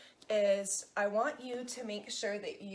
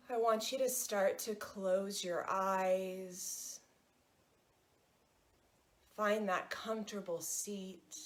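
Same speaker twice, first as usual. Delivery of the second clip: guided meditation; 4 dB quieter than usual. Acted. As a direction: ease them into stillness, unhurried.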